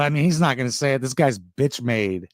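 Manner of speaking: low mock voice. Quoting a clip in low voice.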